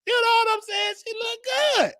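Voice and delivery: very high, excited exclamation; high-pitched giddy hype; excited squeal. Real, not acted. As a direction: squeal about how good she looked. Excited about beautiful woman.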